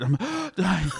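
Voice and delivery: acted gasping for air; mock breathless and spent; out of breath. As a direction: pant it as if winded from sprinting. Acts out gasping under the car.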